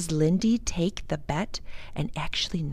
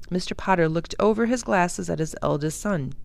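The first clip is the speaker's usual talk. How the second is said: ordinary delivery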